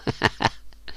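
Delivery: short soft chuckle; quiet, breathy laugh. Acted. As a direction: chuckle low under the breath. Narrator laughs after the decree.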